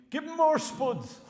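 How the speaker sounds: put-on high, loud, slow drunken bellow; drunk heckler shout. Drunk lad at the back heckling the lecturer, slow loud bellow. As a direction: bawl it from the back of the hall. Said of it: "every two minutes he shouted up at me".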